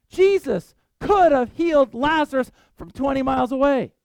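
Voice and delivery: ordinary talk, nothing marked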